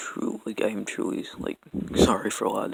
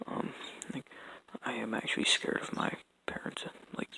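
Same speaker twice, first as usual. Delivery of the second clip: quiet; 8 dB quieter than usual. Real, not acted. Confessing abuse at home.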